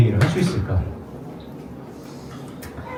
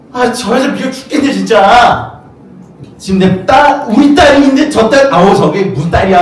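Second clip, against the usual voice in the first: 높고 크게 투덜대는 초조한 아버지; 속 터져 높은 목소리로 퍼붓듯; 짜증 난 야이로; 8 semitones higher than usual. Retold or imitated. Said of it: "하면서 막 속으로 막". (여인 때문에 늦어진 야이로가 속으로 높게 짜증 내며)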